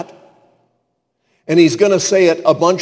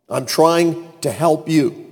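"I'm trying to help you" slowed and spaced out; slow emphatic repeat. Acted. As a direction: drive home "trying to help you" slowly, with pauses. Repeats the line slowly for emphasis.

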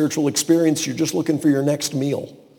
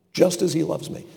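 ordinary delivery

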